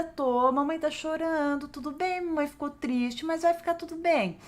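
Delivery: put-on calm the child with gentle honesty; reassuring mother. Shown as a demonstration. Mother validating tears to child, tenderly reassuring. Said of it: "mas você valida".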